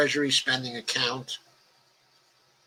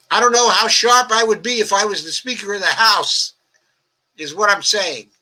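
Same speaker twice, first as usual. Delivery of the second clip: louder and higher, pressing the point hard; emphatic outburst; 7 semitones higher, 6 dB louder than usual. Real, not acted. Host answering chat about being Speaker, loud and emphatic. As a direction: insist on it with a raised voice.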